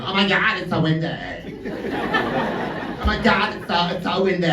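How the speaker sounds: drawn-out mock American accent; complaining American tourist. American tourist moaning about the wind, mock American complaint. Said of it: "two American tourists were complaining about the wind".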